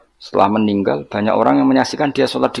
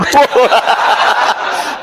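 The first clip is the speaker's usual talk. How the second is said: laugh; 11 semitones higher than usual. Real, not acted. Joke about ugly wife.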